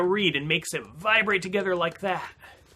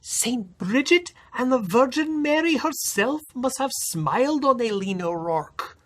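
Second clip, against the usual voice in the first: higher, gossipy Irish village women; gossiping village women; 10 semitones higher than usual. Acted. Village women gossiping about Aileen, high voice. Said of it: "the village women said".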